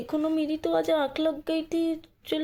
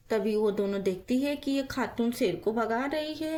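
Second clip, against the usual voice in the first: story narration; 6 semitones lower than usual. A narrator's reading. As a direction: narrate the scene calmly and low.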